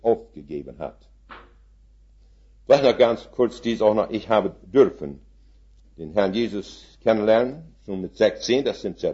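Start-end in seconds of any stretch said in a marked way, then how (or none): none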